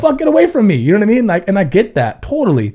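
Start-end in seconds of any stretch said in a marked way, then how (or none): 0.2-0.8 higher, louder mock yell; half-joking shout to shoo a friend away. Imagined brush-off of Martin.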